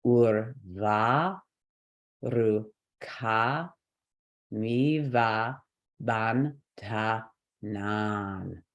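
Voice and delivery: slow separated syllables. Shown as a demonstration. Teacher reading the whole line of a mantra, syllable by syllable. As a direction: sound out each syllable with gaps between. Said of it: "So the whole line".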